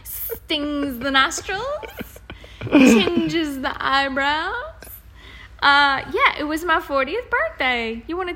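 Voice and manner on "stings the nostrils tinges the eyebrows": mock dramatic. Mock dramatic wine description.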